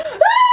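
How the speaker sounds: very high scream of fright; retold shriek, high-pitched; frightened scream. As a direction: let out a scared shriek. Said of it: "freaking out the entire time".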